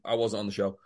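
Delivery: deadpan quoted excuse. Retold or imitated. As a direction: drop the pitch for a mock denial. Quoting Lynn's same excuse, deadpan.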